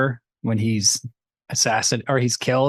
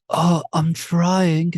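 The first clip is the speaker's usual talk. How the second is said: mock awkward boyfriend; 3 semitones higher than usual. Mocking nervous boyfriend character.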